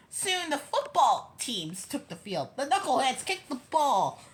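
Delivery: usual speaking voice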